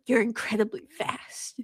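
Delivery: breathy awed whisper, no voice at all; hushed, as if telling a secret; mock whisper. Bella in awe of Edward, in a mock whisper.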